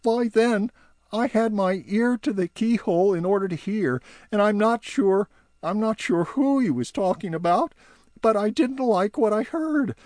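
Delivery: high uneasy elf voice; uneasy little eavesdropper; Barnaby admitting he listened at the keyhole, high. Acted. Barnaby continues.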